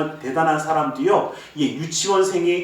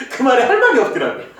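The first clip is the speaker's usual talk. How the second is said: real laugh through the words, a bit higher and quick; 7 semitones higher, 5 dB louder, faster than usual. Real, not acted. Pastor laughing to the congregation about being speechless. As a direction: chuckle while admitting defeat.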